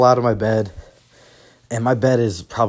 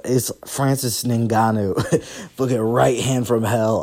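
ordinary talk

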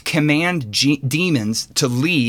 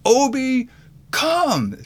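high-pitched imitation of a small child commanding; bold, authoritative toddler; 12 semitones higher, much slower than usual. Three-year-old tries again on the dog, imitated bold, high-pitched command. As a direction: mimic a little kid trying to sound commanding, voice high. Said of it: "in his most bold, deep, authoritative voice".

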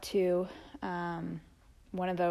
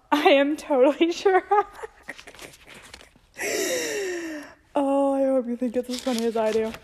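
laughing; 6 semitones higher, 7 dB louder than usual. Real, not acted. Laughing at childhood journal.

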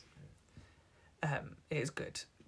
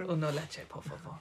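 mock Spanish accent; 3 semitones lower, 6 dB quieter than usual. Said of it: "my Puss in Boots impression".

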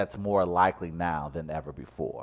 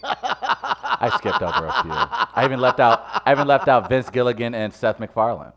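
laughing; 6 semitones higher, 8 dB louder than usual. Real, not acted. Laughing at own long list.

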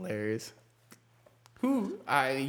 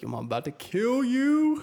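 high-pitched playful outrage; joking furious parent, pitch climbing; mock parental threat; 12 semitones higher, 4 dB louder than usual. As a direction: threaten the kid, hammed-up. Acts out parent threatening own kid.

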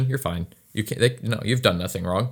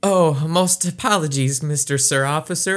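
higher, prim overly formal voice; overly proper; 6 semitones higher than usual. Acted. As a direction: act stiffly polite to a cop. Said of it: "you get all proper and shit".

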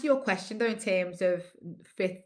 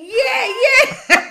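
high, loud mock-excited yeah; excited confirmation; 14 dB louder than usual. Stranger hears his cousin described, excited confirmation. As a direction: imitate an over-eager stranger, voice leaping up loudly. Acting the American's eager confirmation.

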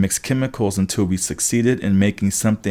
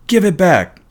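high, loud, urgent demand; indignant demand; 10 semitones higher, 6 dB louder than usual. A narrator's reading. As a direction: demand it back in a raised, upset voice. Narrator demands his snatched photo back.

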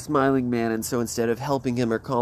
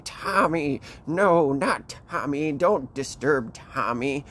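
higher, slow mock wail; distressed axe man; 4 semitones higher, slower than usual. Axe man begging them to spare Tommy's skeleton, mock-distressed. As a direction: plead slowly, stretching the words in hammed-up distress.

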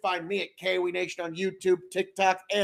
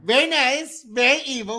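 higher, louder creepy catchphrase; Danhausen impression; 6 semitones higher, 4 dB louder than usual. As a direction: purr it like a spooky wrestler. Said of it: "Shush. No, don't, don't do that".

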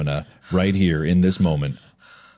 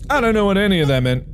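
quick, higher, rushed; fast baffled repeat; 14 semitones higher, 4 dB louder, faster than usual. Real, not acted. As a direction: rattle it off in confusion.

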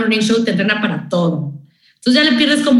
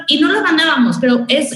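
ordinary talk

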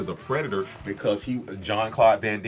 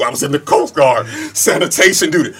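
acted high, loud comeback; hammed-up defensive bellow; defensive shouting; 7 semitones higher, 12 dB louder than usual. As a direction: yell it back as in a mock quarrel. Acted answer in movie quarrel.

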